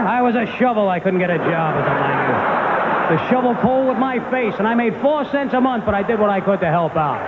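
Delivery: high-pitched, hammed-up dad tirade; mock indignant dad, stretching the boasts; ranting father. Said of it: "My father'd be like".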